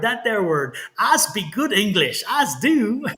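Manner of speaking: slow, broad mock-Texas drawl; hammed-up Texan. Reader joking about his bad English, exaggerated Texan. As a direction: ham up a country Texas accent. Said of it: "I'm a Texas boy".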